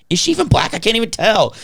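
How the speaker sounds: high-pitched bewilderment; baffled protest, voice jumping up; so confused. Retold or imitated. Patient staring at the ceiling, utterly confused. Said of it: "I was so confused".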